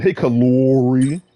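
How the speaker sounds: slow drawn-out Virginia greeting Hey, Kalori; imitating Virginia accent. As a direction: ham up the Virginia way of saying Kalori. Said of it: "they say it again".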